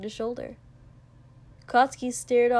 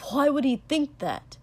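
higher, louder, sharp question; 5 semitones higher, 5 dB louder than usual. A narrator's reading. Katsuki reacting to Kiri's worry, sharp and louder. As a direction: snap the question out. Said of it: "Katsuki said sharply".

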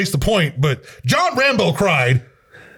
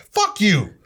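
ordinary talk, nothing marked

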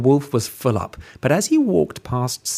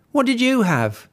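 curious little pig; 11 semitones higher than usual. Acted. Pig asking wolf.

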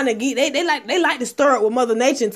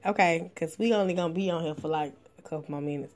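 ordinary delivery

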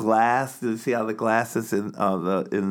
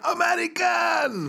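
mock shout. People shouting American at her.

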